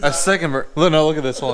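loud laughing. Real, not acted. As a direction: laugh hard while pointing it out. Excited laughing at a friend sweating.

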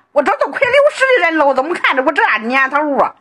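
usual speaking voice

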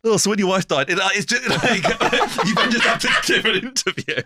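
high, laughing impression of a quiz host; mock Chris Tarrant. Mocking the impersonating interviewer, high and giggly. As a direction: play the host breaking into giggles.

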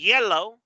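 YELLOW with a gliding Y; emphatic model YELLOW, no J sound; soft Y. Shown as a demonstration. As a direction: say YELLOW starting on ee. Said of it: "es el color".

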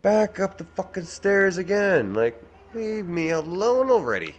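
high, loud parental shout; fed-up holler, pitch way up; exasperated yelling. Real, not acted. Yells at child to leave.

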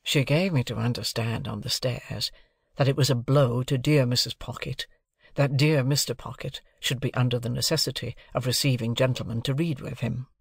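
ordinary delivery

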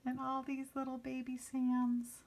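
cooing over babies. Real, not acted. Cooing over baby kittens.

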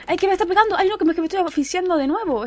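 high, loud alarm. Real, not acted. Player attacked underwater and running out of air, alarmed. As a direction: cry out in a mild panic.

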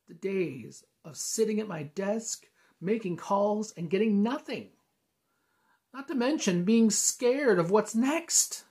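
high, loud, slow and building; desperate outburst. Acted. Venting despair over job.

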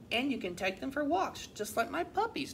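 a hint of an eager pup; barking dog. A narrator's reading. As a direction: pipe up like a friendly storybook dog. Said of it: "barked Daisy".

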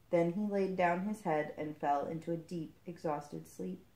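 plain reading voice

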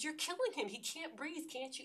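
high, loud desperate yell; screaming at a cop. Retold or imitated. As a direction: yell at the officer to stop. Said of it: "I, I screaming at him".

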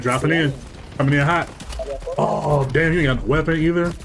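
usual speaking voice

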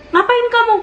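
high-pitched mock yell; acted-out holler, pitched well up; retold shout. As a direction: imitate dad challenging an intruder. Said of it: "terus dia langsung teriak".

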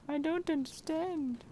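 high, slow squeaky whine. Real, not acted. Player baffled by mole rats, high squeaky whine. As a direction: whine in mock despair.

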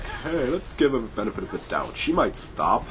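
lightly voiced, naive enemy soldier; a hint of a trusting guard talking himself into mercy; mock enemy voice. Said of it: "They're just like".

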